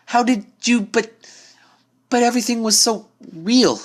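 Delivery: bewildered stammer. Acted. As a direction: stumble over the words in shock. Twilight bewildered after the prank.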